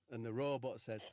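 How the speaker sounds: normal talking voice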